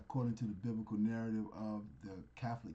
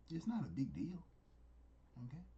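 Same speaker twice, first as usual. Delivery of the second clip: normal talking voice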